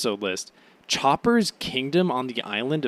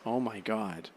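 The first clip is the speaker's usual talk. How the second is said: ordinary talk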